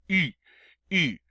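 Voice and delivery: "U" as "ee" through puckered lips; French U. Shown as a demonstration. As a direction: round your lips tightly for "U". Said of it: "say a long E sound through those".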